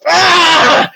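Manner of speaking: high loud pained scream. Acted. Hera screaming as her shoulder burns. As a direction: shriek as the fire hits. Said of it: "She screams".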